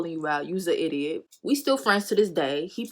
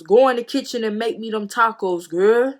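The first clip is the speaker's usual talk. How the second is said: louder mock bossy order; Baby Boy movie voice; 4 semitones higher, 8 dB louder than usual. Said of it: "it was giving baby boy vibes".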